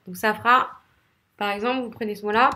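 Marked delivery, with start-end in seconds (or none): none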